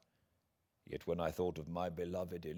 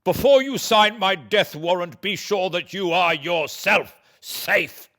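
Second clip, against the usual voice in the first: high, loud furious cry; much higher and louder outburst; exclaimed; 13 semitones higher, 16 dB louder than usual. Acted. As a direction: lunge at him with the words. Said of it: "I started forward and exclaimed".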